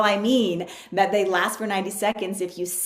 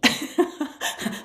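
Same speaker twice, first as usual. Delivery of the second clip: laugh; 8 semitones higher, 3 dB louder than usual. Retold or imitated. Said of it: "and I'd be like".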